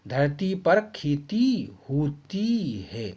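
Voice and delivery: धरती पर खेती होती है drawn out slowly for writing; very slow dictation. Shown as a demonstration. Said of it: "इनको चेंज कर देना".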